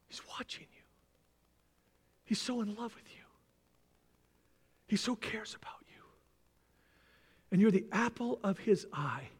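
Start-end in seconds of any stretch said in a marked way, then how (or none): 0.1-6.0 soft unvoiced whisper; tender whisper. Real, not acted. Preacher whispering God's love to the congregation.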